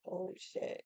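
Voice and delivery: whispered oh shit under breath. Retold or imitated. Server reacts under his breath.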